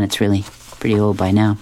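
ordinary delivery, nothing marked

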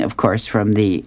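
usual speaking voice

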